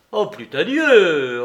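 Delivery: loud, slow mock-pious exclamation; loud slow mock prayer; fervent retort. A narrator's reading. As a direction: shoot back with heartfelt exaggeration. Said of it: "riposta le jeune homme".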